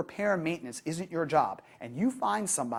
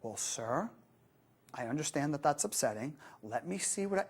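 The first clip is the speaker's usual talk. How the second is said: calm relaxed demeanor; 2 semitones lower, 7 dB quieter than usual. Shown as a demonstration. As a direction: answer as if nothing is wrong, quietly. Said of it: "the calm, relaxed demeanor".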